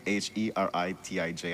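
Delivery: H E R I T I J spelled out letter by letter; spelling out letters. Shown as a demonstration.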